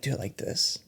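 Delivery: breathy whispered instruction. Retold or imitated. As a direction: whisper it, dead serious.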